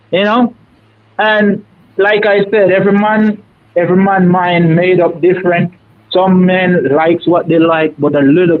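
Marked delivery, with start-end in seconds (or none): none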